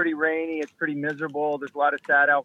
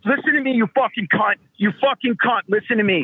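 furious; 7 semitones higher than usual. Real, not acted. Caller loses temper, rants.

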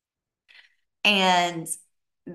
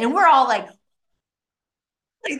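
ordinary talk

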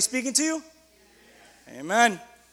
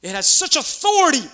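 higher, louder amazed remark; excited exclamation; 5 semitones higher, 6 dB louder than usual. A narrator's reading. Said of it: "they asked, excited".

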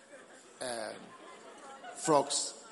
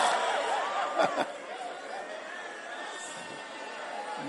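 high breathless laugh; 14 semitones higher, 6 dB quieter than usual. Real, not acted. Laughter after mock quote.

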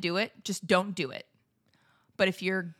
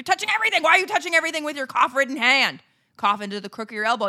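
fast rant; 3 semitones higher, 6 dB louder, much faster than usual. Real, not acted. Ranting lecture on coughing.

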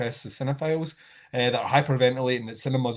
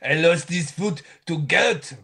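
put-on French accent; 3 semitones higher, 4 dB louder than usual. French guy with the foot voice.

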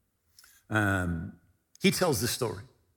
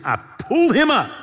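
eager burst; 9 semitones higher, 6 dB louder than usual. Retold or imitated. [name] retelling the healing attempt.

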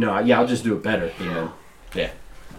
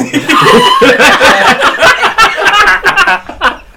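high, loud burst of laughter; howling laugh; big laugh; 8 dB louder than usual. Real, not acted. Laughing at the feed store joke.

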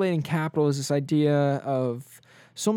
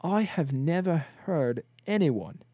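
imitating stunned teacher; 2 semitones higher than usual. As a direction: drag it out like a British professor whose mind is blown. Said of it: "completely blown".

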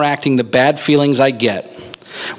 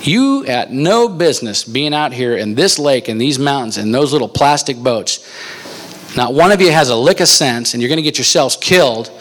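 berating; 3 semitones higher than usual. Retold or imitated. Angry fisherman scolds young kayakers, berating them. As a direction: imitate an angry local tearing into someone. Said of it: "he just starts berating us".

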